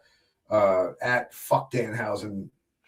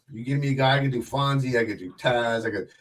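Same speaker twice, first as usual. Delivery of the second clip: ordinary delivery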